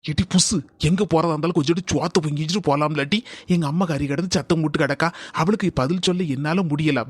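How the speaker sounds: low, soft, furtive phone talk; hushed murmur. Acted. Husband on phone to wife about his noisy mother, hushed. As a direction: keep it down so someone nearby won't overhear. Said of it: "அங்க என்ன தல குசு குசுன்னு பேசிட்டு இருக்க".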